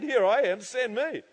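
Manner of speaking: mock-eager offer; imitated volunteering. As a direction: declare it readily, voice lifted. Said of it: "That's what Marty did".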